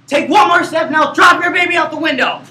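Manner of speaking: loud, high hammed-up menace; villain threat. Shown as a demonstration. Actor shows the life-or-death threat, loud hammed villain. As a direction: bellow the threat.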